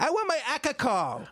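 put-on high whiny toddler demanding his booze; baby alcoholic voice. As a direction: play a tiny drunk baby wanting a drink. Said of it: "and he's just like".

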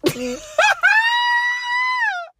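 very high loud screech of victory; triumphant scream. Real, not acted. Victory scream after getting Ganesha. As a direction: scream your win.

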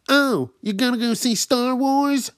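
loud, high, drawn-out mimicry; high-pitched mocking caricature; obnoxious mocking guy. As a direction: imitate an overbearing loudmouth.

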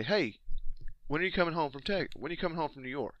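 rushed, casual and quick; normal human phrasing. Shown as a demonstration. Showing how a normal person asks it, said quickly. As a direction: toss it off fast like everyday talk. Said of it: "a normal human would say".

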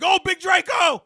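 loud, high hype call; hype shout. Retold or imitated. Hosts hyping the angry alter ego, loud hype shout. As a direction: shout it like a crowd. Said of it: "So they was like".

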